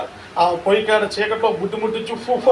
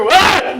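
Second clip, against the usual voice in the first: sudden frightened yell, higher and louder; mother's scream; 7 semitones higher, 6 dB louder than usual. Retold or imitated. Mother screaming at the child she took for a ghost, frightened yell. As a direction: scream as if you saw a ghost.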